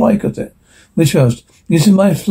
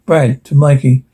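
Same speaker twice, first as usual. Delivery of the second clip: ordinary delivery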